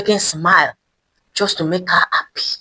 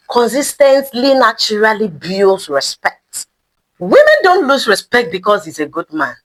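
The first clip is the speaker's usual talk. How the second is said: ordinary delivery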